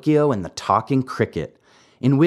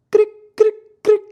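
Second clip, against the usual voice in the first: high, sharp cricket chirps, louder; cricket chirping; 6 dB louder, faster than usual. A narrator's reading. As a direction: chirp like a tiny cricket in the dark. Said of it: "heard someone saying".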